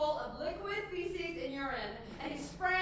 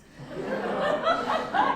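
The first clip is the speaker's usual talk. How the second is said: a loud burst of high laughter; 12 semitones higher, 10 dB louder than usual. Real, not acted. Laughs at his own story.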